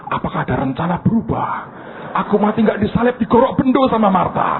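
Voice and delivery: hammed-up fright to the Father; mock alarm. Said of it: "Tuhan kaget". (panik pura-pura, suara naik)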